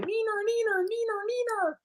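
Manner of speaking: very high squeaky taunting voice; high sing-song mock taunt; taunting robot gnome. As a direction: tease like a bratty little machine. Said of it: "he's just the whole time".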